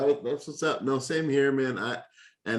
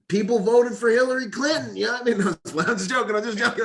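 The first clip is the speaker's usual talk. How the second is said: joking jab; 12 semitones higher, 5 dB louder than usual. Acted. Joking provocative jab on podcast.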